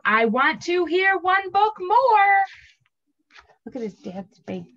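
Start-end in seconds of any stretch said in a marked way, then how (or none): 0.0-2.4 shout. Acted. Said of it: "stomp his feet on the floor and shout".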